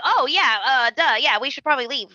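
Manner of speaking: mock awkward agreement. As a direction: lightly imitate flustered friends excusing themselves. Retold awkward friends excusing themselves.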